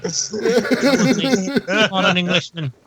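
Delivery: mock English aristocrat, slow and laughing; hammed-up English accent. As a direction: play a pompous English lord, stretching every word. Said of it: "Wait, I am English".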